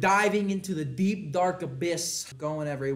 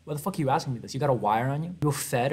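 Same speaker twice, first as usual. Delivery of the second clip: ordinary talk, nothing marked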